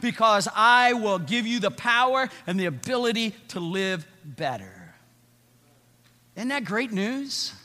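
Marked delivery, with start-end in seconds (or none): 0.0-4.9 loud, raised preaching voice of Jesus; Jesus declaring sins forgiven, rising intensity. Acted. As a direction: proclaim forgiveness with full force. Preacher voicing God's forgiveness.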